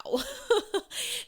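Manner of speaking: high, loud burst of laughter; sudden high-pitched laugh; real laugh. Real, not acted. Laughs after retelling coach's reaction.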